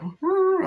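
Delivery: very high, loud mock howl; creature howl. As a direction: wail like the mutating dog. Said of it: "they're like".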